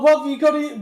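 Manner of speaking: usual speaking voice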